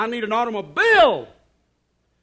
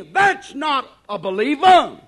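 ordinary talk

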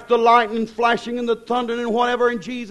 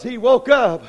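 usual speaking voice

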